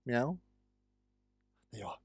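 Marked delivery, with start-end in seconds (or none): 0.1-0.4 playful meow at the cat; mock meow. A gamer meowing at a Minecraft cat, playful. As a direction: meow softly to lure it. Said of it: "I don't know why I'm doing that".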